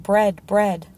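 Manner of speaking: loud repeated crowd chant; clamoring. A narrator's reading. Hungry mob demanding food, clamoring. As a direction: punch each word like a chant. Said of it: "they clamored".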